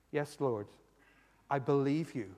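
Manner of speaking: low, soft sincere response; quiet prayer. Acted. Worshipper answers the Lord's call, quietly sincere.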